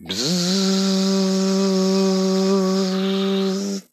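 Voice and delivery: high, long imitated buzz; a mouth-made buzz; buzzing noise. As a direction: buzz like an insect.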